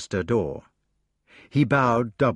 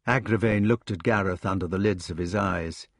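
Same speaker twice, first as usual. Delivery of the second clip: ordinary delivery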